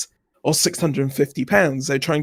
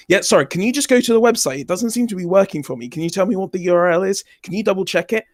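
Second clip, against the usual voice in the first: quick, higher-pitched apologetic request; feigned innocence; 5 semitones higher, faster than usual. Retold or imitated. Customer stringing along a scammer selling a URL, innocently puzzled. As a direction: play the puzzled customer, talking fast.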